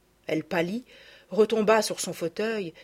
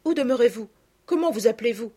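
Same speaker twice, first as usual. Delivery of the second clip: grateful maid; 5 semitones higher, 7 dB louder than usual. Acted. Maid thanks the carter.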